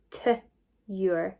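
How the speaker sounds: T and YOUR as two separate sounds; sounding out. Shown as a demonstration. Teacher sounding out a word for pupils, T and YOUR kept apart. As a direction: say T, pause, then YOUR. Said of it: "and then the cure part".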